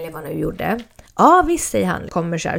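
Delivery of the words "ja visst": high, bright and loud "sure thing"; eager little brother. Retold or imitated. Little brother Elton eagerly agreeing to hold the pump, high and loud. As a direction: chirp it out like a keen kid.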